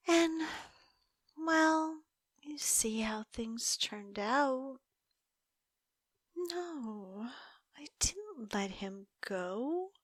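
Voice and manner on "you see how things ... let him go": whisper. Acted. Whispering to the detective how her captive got away. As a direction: hush it like a guilty secret. Admitting the escape.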